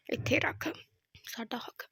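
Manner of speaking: hushed Punjabi whisper. Acted. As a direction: breathe it without voice. Whispered aside after the slap.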